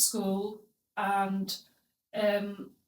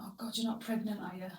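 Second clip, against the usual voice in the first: imitated whisper; 11 dB quieter, faster than usual. Grandma's shocked reply retold.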